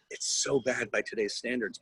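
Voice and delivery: normal talking voice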